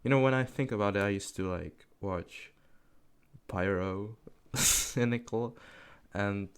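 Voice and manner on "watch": ordinary talk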